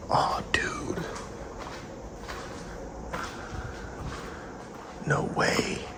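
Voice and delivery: breathless awed whisper; amazed whisper. Real, not acted. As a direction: whisper it in disbelief. Finds stash in abandoned factory.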